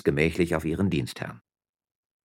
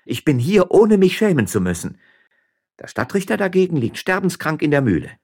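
higher-pitched, defiant wife; indignant miller's wife; 7 semitones higher, 5 dB louder than usual. Acted. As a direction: stand up to him, voice raised.

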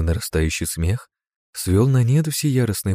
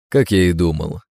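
loud mocking sneer; 4 dB louder than usual. A narrator's reading. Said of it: "с насмешливой ухмылкой ... как можно громче".

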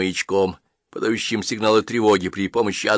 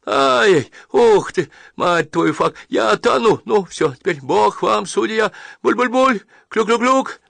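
drowning panic; 10 semitones higher than usual. Acted. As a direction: cry out as the water takes you. Drowning man's last cries.

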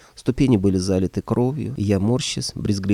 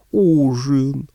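gentle booming monster, louder; friendly monster voice; 6 semitones higher, 6 dB louder than usual. Acted. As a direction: tenderly boom out the word. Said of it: "ласково пробасил мужик".

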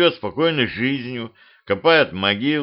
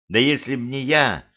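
plain reading voice

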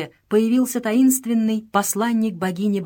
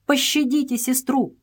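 higher, mildly pleading cry; begging; 6 semitones higher than usual. A narrator's reading. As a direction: beg the princess for mercy. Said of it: "умоляла она".

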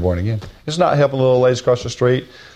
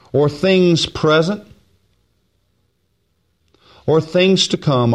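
slow, raised scripture reading; preaching; 6 semitones higher, slower than usual. Acted. Preacher reading the verse aloud, slow and raised. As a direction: lift each phrase, unhurried.

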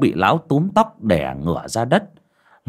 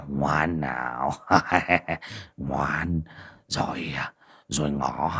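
low, very slow, soft lecherous coaxing; leering coax; 6 semitones lower, 6 dB quieter, much slower than usual. Acted. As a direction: croon like a predator soothing his prey.

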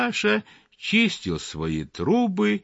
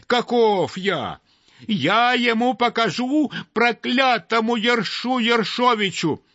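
sparrow sputtering in fury; furious yelling; 11 semitones higher than usual. A narrator's reading. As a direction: sparrow yelling at the sweep, choking with rage. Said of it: "орал Воробей Воробеич, задыхаясь от ярости".

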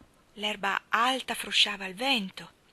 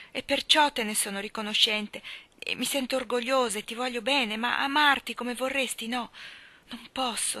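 Jo, earnest and pained, pleading louder; 4 semitones higher, 5 dB louder than usual. A narrator's reading. Jo refusing Laurie's love: pained pleading. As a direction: plead gently but firmly with your friend.

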